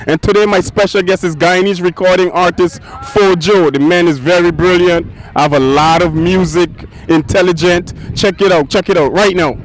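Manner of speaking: loud, high hype-man welcome, riding every line; hyped show host intro. Acted. Host opening his show for viewers, hyped and loud.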